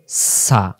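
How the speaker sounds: সা with a thin, whistling s; thin hissing sin. Shown as a demonstration. Teacher demonstrating sin, thin sibilant sound. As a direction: hiss the s lightly in সা. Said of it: "সির সির ও পাতলা আওয়াজে".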